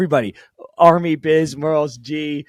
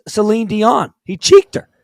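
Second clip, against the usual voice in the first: high, loud drawn-out astonishment; real, amazed outburst; incredulous excitement; 8 semitones higher, 8 dB louder than usual. Real, not acted. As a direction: build slowly into a high, loud exclamation. Astonished at the cheek kiss.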